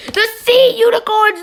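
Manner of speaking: high, loud team call; big countdown announcement; announcer reveal. Pretend. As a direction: punch the name out high. Announcing number two team.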